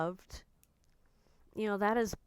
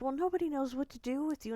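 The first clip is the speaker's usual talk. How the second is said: higher, quicker voice imitating a dad; imitating dad's retort; 6 semitones higher, faster than usual. Dad to daughter mid-argument, imitated higher and quicker. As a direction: toss off dad's retort in a raised, hurried voice.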